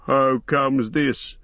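slow, stern challenge. A narrator's reading. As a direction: demand it sternly, drawing out the words. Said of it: "said Theoden sternly".

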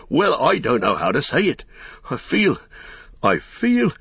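high, halting bursts of joy; overjoyed. A narrator's reading. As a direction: grope for words in rising excitement. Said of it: "he cried".

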